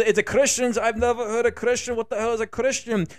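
baffled, high-pitched fake Russian accent; Russian-accented Jesus. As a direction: ask in a bewildered mock Russian accent. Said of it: "why am I giving Jesus a Russian accent".

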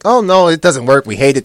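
loud, high mock complaint; mocking Republicans. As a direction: ham up the whiny complaint. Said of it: "Republicans are just like".

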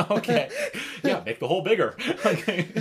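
laughing loud and high; giggling through the words. Real, not acted. Laughing at drill joke.

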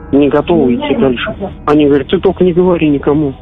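ordinary delivery

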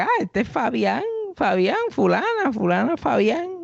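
high, slow, bright introduction; hammed-up girl doing introductions, pitched high; cheerful girl. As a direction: mimic a chirpy friend making introductions. Girl introducing him to her friend.

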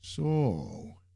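a drawn-out, curious "So"; intrigued. A narrator's reading. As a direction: perk up, lightly intrigued. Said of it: "said with an intrigued tone".

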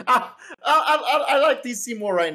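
laughing through the words; breaking into laughter mid-sentence. Real, not acted. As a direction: giggle out the confession. Laughing through his admission.

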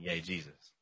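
low, soft mock cheer; half-hearted retold cheer; deadened crowd cheer. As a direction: toss off the cheer quietly, without any lift. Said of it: "when everybody's going".

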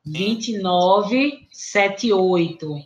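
slowly repeating the digits; slow dictation. Real, not acted. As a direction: read each digit clearly and slowly. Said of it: "Repete, repete, por favor".

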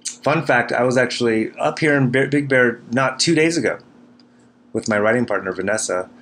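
ordinary delivery

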